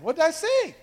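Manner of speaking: high, puzzled what did I say; mock confused reply. Partner caught off guard, high and puzzled. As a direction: play the baffled partner. Acting out partner's trigger quarrel.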